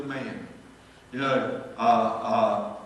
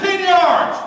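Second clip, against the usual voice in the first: referee call; 13 dB louder than usual. Retold or imitated. Referee calls penalty.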